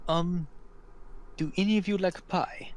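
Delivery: friendly host offering food, unsure of details; hesitant Laszlo. Acted. Laszlo offers travelers breakfast pie, hesitant and high. Game master voices Laszlo.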